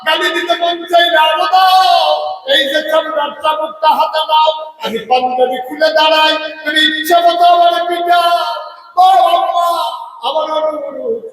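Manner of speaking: high, loud preaching lament; impassioned mock-weeping cry; acted tearful plea. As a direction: plead in a high wailing chant. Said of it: "Omar হাউ হাউ করে কাঁদতেছে".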